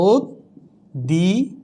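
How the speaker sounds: অদ্বিতীয় very slowly, "od... di... tiyo"; syllable-by-syllable demo. Shown as a demonstration. Teacher spelling out the pronunciation, slow syllable demo. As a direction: break অদ্বিতীয় into drawn-out pieces with doubled d. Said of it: "তাহলে হয়ে যাচ্ছে উচ্চারণটা".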